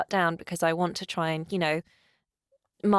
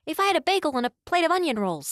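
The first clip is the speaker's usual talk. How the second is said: put-on high, quick New York accent; 9 semitones higher, faster than usual. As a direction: rattle it off as a New York diva. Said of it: "do my [name] impression".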